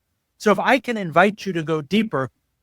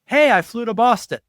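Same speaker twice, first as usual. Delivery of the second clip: higher, brighter eager news; eager announcement; 5 semitones higher, 4 dB louder than usual. Retold or imitated. Telling family about a trip, eager announcement. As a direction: share your trip like you're bursting to tell it. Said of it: "I could tell a loving member of my family".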